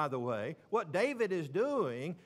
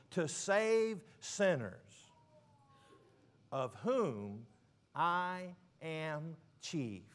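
slow solemn scripture; much slower than usual. Acted. Preacher quotes Paul in First Timothy.

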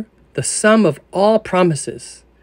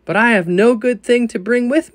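a pleading sinner, pitched up; anxious sinner's plea; 7 semitones higher than usual. Retold or imitated. Reader voicing the doubting sinner to the listener, pleading. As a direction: voice the sinner's objection, lifted higher. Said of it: "say you".

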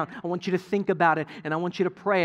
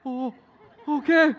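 higher, hesitant mock-stunned reply; halting, pitched-up kid response; imitating stunned kids; 5 semitones higher than usual. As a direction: play a child too stunned to say much. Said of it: "and they're, like, stunned".

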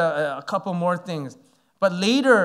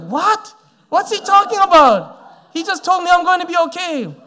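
higher and louder, stunned outrage; shocked disbelief; 9 semitones higher, 6 dB louder than usual. Retold or imitated. Young pastor reacting to the sermon, high shocked disbelief. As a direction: cry out in disbelief.

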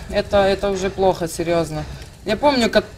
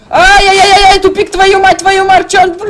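high, loud panicked curses; panicked yelling; 10 semitones higher, 9 dB louder than usual. Real, not acted. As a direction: yell in frantic panic. Hits dead end, panics.

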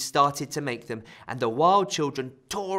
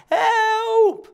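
distant cry for help; 11 dB louder than usual. Acted. A voice calling out for help.

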